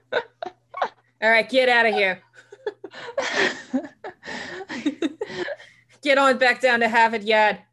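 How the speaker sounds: laughing through a mock Boston accent. Sherpa sending climbers down, hammed-up Boston accent. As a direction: send them off like a brusque Bostonian. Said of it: "giving the Sherpa a Boston accent".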